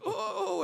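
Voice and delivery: very high, loud "oh"; mock cry of alarm. Old lady hanging on the banister, high mimicked wail. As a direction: imitate a frail lady's startled high cry.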